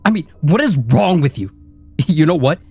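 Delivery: from a sneering taunt to a high screaming rant; building to a scream. Acted. Inner critic rant builds to screaming.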